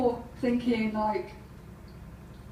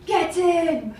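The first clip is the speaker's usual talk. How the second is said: high, raised cheer; victory cry; 5 semitones higher, 4 dB louder than usual. Retold or imitated. Poker player after another minor victory, triumphant cry. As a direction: celebrate a little win with a punchy shout. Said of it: "Then she'd have another minor victory".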